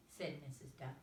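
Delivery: usual speaking voice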